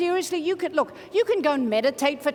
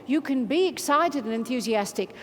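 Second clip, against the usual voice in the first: normal talking voice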